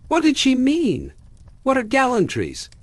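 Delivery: high, scandalized questioning; shocked. A narrator's reading.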